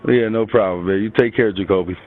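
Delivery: ordinary talk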